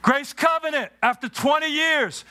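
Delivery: high, driving preacher's cadence with shouted peaks; full-force preaching. Acted. Pastor preaches to the congregation at Grace Covenant, high and fervent. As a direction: preach it with rising fire.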